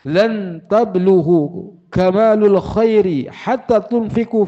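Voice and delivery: high, projected, declaimed reading; preacher's recitation. Acted. Preacher reading out commentary on giving what one loves, declaimed recitation. Reads out Arabic commentary and its translation.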